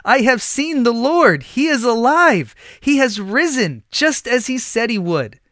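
high, loud jubilant call; joyful calling. A narrator's reading. Mary pounding on the door to the disciples, jubilant. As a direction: shout the news through the door. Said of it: "calling to the disciples".

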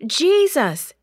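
high, loud call of a name; frightened disciple shouting for Jesus in the storm; shouted name. A narrator's reading. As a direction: call out in panic over the storm. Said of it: "he shouted".

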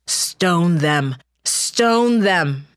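loud, rising angry chant; a furious crowd demanding stoning; mob shouting. Acted. Angry mob turns violent.

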